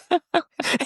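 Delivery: high chuckling laugh; real laugh. Real, not acted. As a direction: laugh at your own story. Amused by own retold banter.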